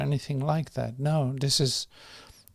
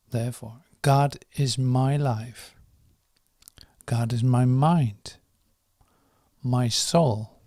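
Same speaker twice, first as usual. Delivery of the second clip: slow, measured prayer with long pauses; slow prayer reading; 4 dB louder than usual. Real, not acted. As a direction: read each phrase firmly and deliberately, letting it settle. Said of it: "I'll read the first three lines one more time".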